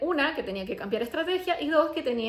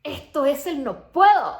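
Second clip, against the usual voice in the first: slow, much louder declaration; loud realization; 4 semitones higher, 10 dB louder, slower than usual. Retold or imitated. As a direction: land it like a sudden revelation.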